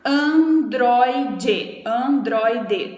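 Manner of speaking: "androide" in three separate syllables, an-droi-de; split into syllables. Shown as a demonstration. Teacher showing the class three syllables, "androide" broken into an-droi-de. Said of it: "a gente tem três sílabas".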